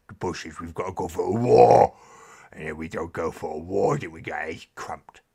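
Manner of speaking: loud, rough mock orc rambling. Streamer [name] showing viewers his loud orc voice. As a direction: ham up a brutish orc talking war. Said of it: "doing the orc voice".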